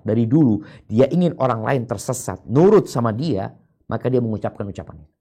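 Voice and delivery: normal talking voice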